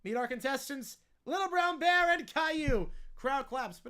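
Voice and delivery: ordinary talk, nothing marked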